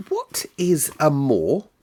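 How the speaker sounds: a lightly voiced curious girl; sudden child question. Mary suddenly asks Medlock, lightly voiced child. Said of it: "she said suddenly".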